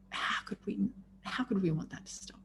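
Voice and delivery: soft unvoiced whisper. Real, not acted. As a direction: breathe it out in awe. Awed rhetorical question.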